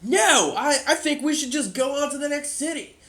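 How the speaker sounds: high, loud, defiant protest; doubting townsman challenges the new king, loud; angry townsman. Acted. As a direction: shout him down in disbelief. Townsman answers the player.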